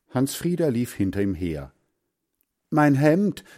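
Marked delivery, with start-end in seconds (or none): 2.7-3.4 raised, higher cry; calling out. A narrator's reading. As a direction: call out in alarm, louder. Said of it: "rief Hans Frieder". (laut ausgerufen)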